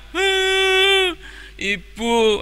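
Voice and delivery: put-on high, crying little boy calling to his mother; crying child. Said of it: "Tony sambil menangis menjawab". (anak kecil memanggil ibu sambil menangis)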